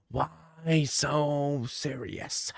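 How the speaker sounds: slow, drawn-out Joker menace, spoken soft; slow Joker line. Acted. Telling his friend the news in a slow Joker voice. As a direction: let each word hang like the Joker. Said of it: "you say".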